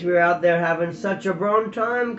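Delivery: a broad Highland burr, jesting; Highland accent. Acted. Prisoner teasing his cellmate, Highland accent. Doogie speaks to Tam.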